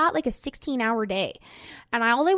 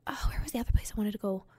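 fast whisper to herself, searching her memory; whispered aside; 6 dB quieter, much faster than usual. Real, not acted. Listing travel spots to fans, fast whispered aside. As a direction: mutter it under her breath quickly. Forgetting a place mid-list.

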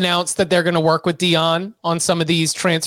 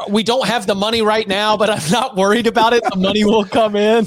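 imitating Deion; 4 semitones higher than usual. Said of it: "get some quotes like".

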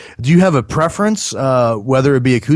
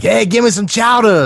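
mock Southie yell; 9 semitones higher than usual. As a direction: yell it like a rowdy Boston local, half joking. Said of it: "like, yelling".

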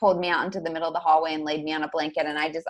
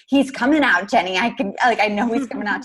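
higher, louder urgent cry; insist, panicked and loud; urgent insistence; 4 semitones higher, 5 dB louder than usual. Retold or imitated. Mother telling doula Jenny the baby is coming, loud urgent cry.

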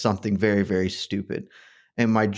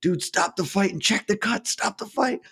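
high-pitched urgent plea, reenacted; 9 semitones higher than usual. Bettor reliving his plea to the TV to stop the fight, urgent high plea. Voicing his panicked reaction while watching.

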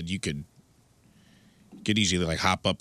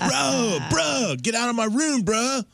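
high mock-teen shout; junior high bro; slower than usual.

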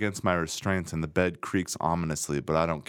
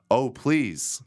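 lightly acted plea, pitched up; a hint of steamy begging, higher than usual; mock-sexy plea; 5 semitones higher, 3 dB louder than usual. Reading a steamy scene on a podcast, mildly sexy plea. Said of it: "That's the sexiest anyone has ever shouted".

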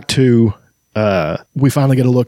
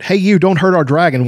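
put-on little boy yelling; 7 semitones higher than usual. A narrator's reading. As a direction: shout it to protect the dragon. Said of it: "one little boy yelled".

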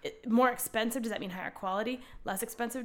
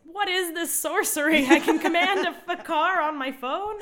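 high, loud awestruck voice; amazed disbelief; 8 semitones higher, 7 dB louder than usual. Retold or imitated. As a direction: act stunned by new magic. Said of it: "he was like".

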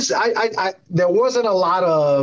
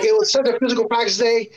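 ordinary delivery

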